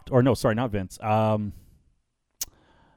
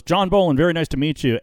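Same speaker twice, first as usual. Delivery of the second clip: higher and louder nervous blurt; startled blurt; 6 semitones higher, 5 dB louder than usual. Retold or imitated. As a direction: spin round and blurt the rehearsed line. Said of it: "he turns around and he goes".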